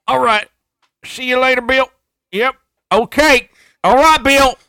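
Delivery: very loud, high Southern dad sign-offs; Southern dad impression. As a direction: holler goodbyes like a hammed-up Southern dad. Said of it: "it's literally your dad".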